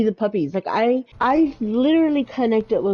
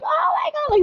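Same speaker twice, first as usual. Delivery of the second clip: imitating a very high, squealing gasp at a dog; squealing excitement. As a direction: squeal it like someone who just spotted a dog. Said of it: "Noodles will see a dog and she'll be like".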